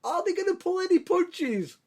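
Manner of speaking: squeaky high voice; high mock question. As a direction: pitch it way up. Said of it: "when you expect them to say".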